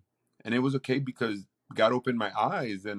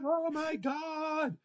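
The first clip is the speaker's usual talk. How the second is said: much higher, slow stunned gasp; shocked; much slower than usual. Retold or imitated. Mom on the phone, stunned and high. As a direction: mom reeling from the news. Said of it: "my mom went crazy on the phone".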